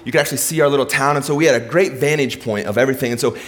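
ordinary delivery

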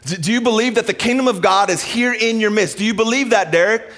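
God calling him out by name; God speaking. Retold or imitated. God confronting Derek in the aisle, high and searching. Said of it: "this moment hit me".

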